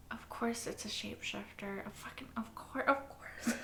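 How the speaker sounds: unvoiced, under the breath; whispered self-reproach. Real, not acted. Stunned viewer whispering to herself about the twist. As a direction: whisper it to yourself in disbelief.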